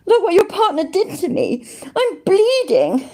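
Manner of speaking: hammed-up whining "I'm bleeding"; mock whiny victim. Mocking the victim's complaint.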